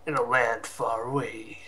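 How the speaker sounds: ominous trailer narrator, lightly done; a hint of epic trailer voiceover; horror trailer voice. Shown as a demonstration. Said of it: "movie trailer voiceover".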